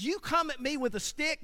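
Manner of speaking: high, scornful taunt; Goliath mocking. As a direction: laugh at the stick. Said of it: "he says".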